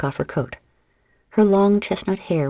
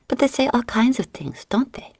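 whisper; 8 semitones higher than usual. Acted. Marie Anne teasing Binge.

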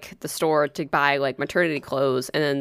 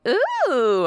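high, drawn-out ooh; admiring coo; 9 semitones higher than usual. Retold or imitated. Two friends seeing the nail polish, delighted coo. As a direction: imitate delighted admiration in one high sound. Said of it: "we were both like".

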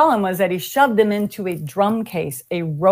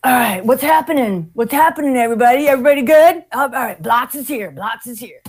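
higher hail-everybody patter, easing off at the end; imitated swaggering entrance; 3 semitones higher than usual. Storyteller plays the drummer arriving at his kit, breezy mimicked greeting. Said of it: "he walked in and he's all like".

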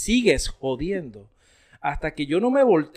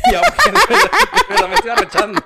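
much higher and louder, laughing through it; 8 dB louder, faster than usual. Real, not acted. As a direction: try to sound angry but crack up. Laughing while claiming anger. (riéndose, muy agudo y fuerte)

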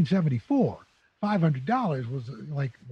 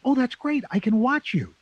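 proud mother; 8 semitones higher than usual. Retold or imitated. Mother voiced in story.

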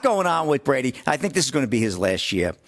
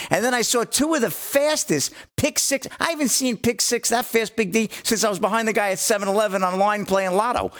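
high-pitched, excited sports recap; real excitement; 8 semitones higher than usual. Real, not acted. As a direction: relive the plays with raised pitch and real excitement. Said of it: "you a little excited over there".

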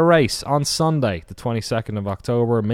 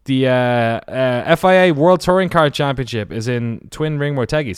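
ordinary talk

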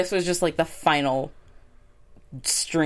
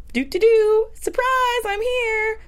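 much higher, sing-song and louder teasing reveal; sing-song surprise; 4 dB louder, slower than usual. Pretend. Playing Anita popping back up to taunt Mike and Harvey, high sing-song. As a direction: trill it out like a gloating surprise guest. Mock villain surprise entrance.

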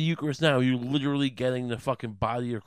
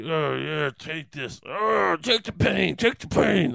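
hammed-up groaning, rising louder and higher; Jesus in pain; 4 semitones higher, 4 dB louder, slower than usual. Jesus cutting his own thigh, strained mock-agonised groans. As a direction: grunt it out through mock agony. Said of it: "Jesus was just cutting to his own thigh".

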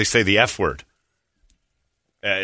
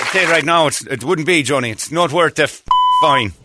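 put-on Irish lilt, lifted a little; Irish accent; 4 semitones higher than usual. Host warns himself off swearing, put-on Irish accent. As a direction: say it like a chiding Irishman. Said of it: "every thought I have is in, is in an Irish accent".

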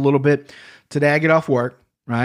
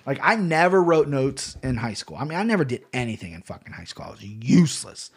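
ordinary delivery

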